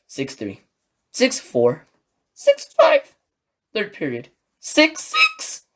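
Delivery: a fan's voice breaking as the comeback unfolds; rising despair. Shown as a demonstration. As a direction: climb in pitch with every goal as the lead slips away. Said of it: "I'm quite intentionally doing that because that's how I was feeling".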